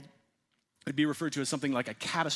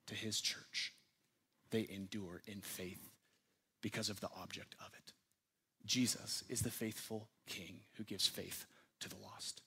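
soft, near-whispered devotion, much softer; lower, hushed and intimate, let it sink in; hushed preaching; 5 semitones lower, 10 dB quieter than usual. Acted. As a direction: drop to a reverent whisper. Hushed close of the point.

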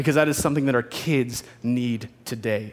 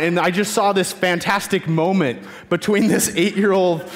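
ordinary delivery

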